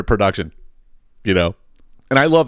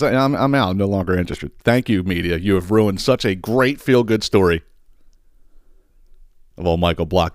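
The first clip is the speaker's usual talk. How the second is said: ordinary talk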